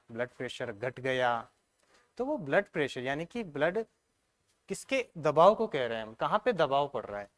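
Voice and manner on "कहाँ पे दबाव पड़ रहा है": ordinary talk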